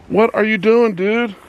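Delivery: high squeaky voice scolding a pet. Real, not acted. Scolding the goat buck again.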